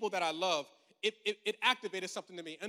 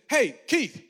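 a called-out greeting, higher and louder; calling out; 5 semitones higher, 9 dB louder than usual. Retold or imitated. Brent calling Keith over from across the playground. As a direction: shout his name across the yard.

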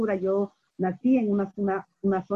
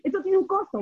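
incredulous asker; 10 semitones higher than usual. Retold or imitated. Retells people asking about charging.

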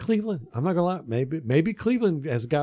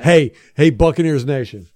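loud, raised hail to the fans; calling out; 3 semitones higher, 9 dB louder than usual. Real, not acted. Host rallying Buccaneers Nation, calling out loudly. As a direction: shout out to the whole fan base.

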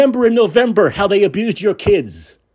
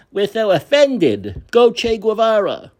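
mocking; 4 semitones higher than usual. Mimicking Gen X leftists.

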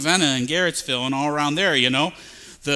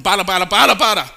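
loud burst of nonsense syllables; worked-up babbling; 6 dB louder, faster than usual. Acted. Preacher mimicking pagans working themselves up in prayer, frantic babbling. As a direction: babble louder as if working yourself into a frenzy. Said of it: "work yourself up".

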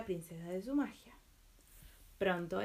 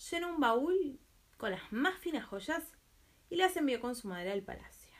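hushed storytelling; 5 semitones higher than usual. A narrator's reading. Narration slows and hushes.

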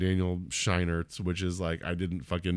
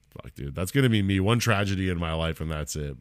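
ordinary delivery

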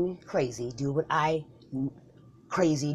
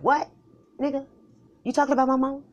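offended; 10 semitones higher, 5 dB louder than usual. Acted. Offended at mother joke.

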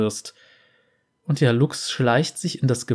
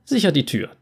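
ordinary delivery

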